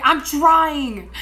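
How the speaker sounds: defensive arguing. Retold or imitated. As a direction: push back loudly in a retold squabble. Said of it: "Like, we're fighting".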